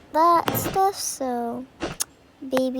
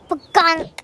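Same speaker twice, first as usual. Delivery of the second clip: loud, higher comic "pagonk"; imitated impact noise; 4 semitones higher, 9 dB louder than usual. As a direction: blurt out a sudden sound effect. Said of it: "it was just like".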